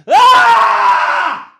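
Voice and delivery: long, high, held scream; sustained scream of frustration; loud scream. Real, not acted. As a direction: scream it out at full volume. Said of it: "sorry if that screaming's clipping".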